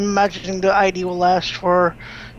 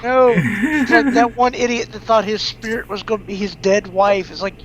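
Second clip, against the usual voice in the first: ordinary talk, nothing marked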